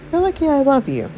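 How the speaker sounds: high-pitched, playful tease; affectionate teasing. Acted. Wife to her underdressed husband, affectionate teasing. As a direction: tease your partner in a lifted, high voice.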